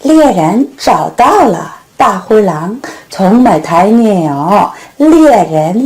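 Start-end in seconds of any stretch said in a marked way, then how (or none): none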